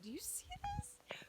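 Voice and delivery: whispered astonishment at a double rainbow; amazed whisper. Real, not acted. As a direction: whisper in disbelief at the sky. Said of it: "I'm freaking out".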